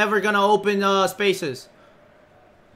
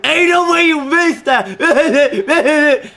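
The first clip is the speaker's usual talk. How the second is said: slow, drawn-out, higher mocking voice with laughter; mocking imitation of teammate; 5 semitones higher, 4 dB louder, much slower than usual. Mocking Smoozy's voice after his miss, slow and laughing. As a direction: laugh while parroting his whine. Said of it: "That's how you sound".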